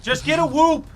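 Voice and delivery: loud, insistent, high-pitched yell; shouting. Real, not acted. Shouted retort in candy argument.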